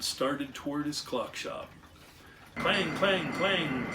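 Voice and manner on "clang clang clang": high, loud repeated clangs; forceful, rhythmic clanging; screeching trolley bell. A narrator's reading. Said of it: "screeched the trolley car".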